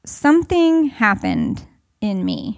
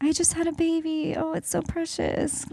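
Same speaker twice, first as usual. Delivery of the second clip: soppy new mom; 6 semitones higher, 5 dB quieter than usual. Retold or imitated. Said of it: "it wasn't because I was like".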